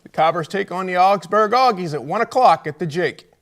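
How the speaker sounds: upbeat radio sign-off; announcer sign-off. Acted. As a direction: sign off the show like a broadcaster. Closing show announcement.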